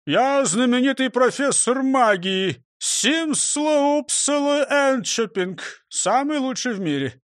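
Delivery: high, slow, showy self-introduction; grand boasting. Acted. Robber poses as magic professor to constable, grandly boastful. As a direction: proclaim the title grandly, stretched and high.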